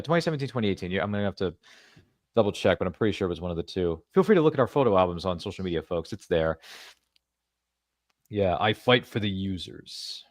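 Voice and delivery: normal talking voice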